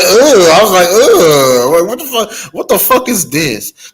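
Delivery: loud, high, disgusted ugh; disgusted outburst. Retold or imitated. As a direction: recoil loudly in mock disgust. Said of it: "You go, I'm just like".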